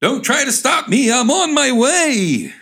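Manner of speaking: high, loud hammed-up cry. Young man walking out on his father, crying out. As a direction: call it out high, stretching the end. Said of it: "cried the young man to his father".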